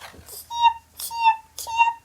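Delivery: very high tiny chirps; chick chirps. Acted. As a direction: peep like newborn chicks.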